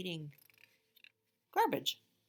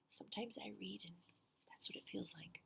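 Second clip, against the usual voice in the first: soft whisper to the listeners; hushed confiding aside; 10 dB quieter than usual. Real, not acted. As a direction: lean in and whisper it.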